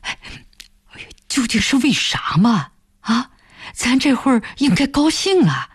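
flustered man, pitch climbing at the end. A narrator's reading. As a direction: plead in mild panic, baffled. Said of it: "开田慌了".

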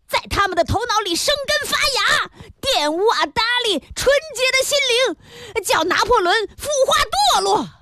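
high-pitched agitated tirade; indignant scolding. A narrator's reading. Pharmacist berating his apprentice over the bad book, worked up and indignant. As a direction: berate a culprit, voice climbing in outrage.

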